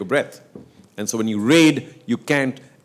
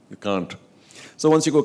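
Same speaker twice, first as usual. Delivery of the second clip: usual speaking voice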